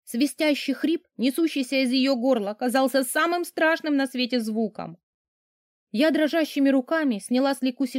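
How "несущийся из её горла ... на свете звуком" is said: ordinary delivery, nothing marked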